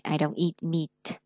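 slow "I don't eat meat" with long ee in "eat" and "meat"; đọc chậm, kéo dài âm i; slow model long ee. Shown as a demonstration. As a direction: stretch "eat" and "meat" slowly. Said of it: "E E là y dài".

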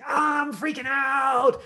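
loud, slow, high panicked shout; freaking out. Shown as a demonstration. Actor exploring a freak-out, shouts in panic. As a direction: wail it out in full panic. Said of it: "just gonna try saying".

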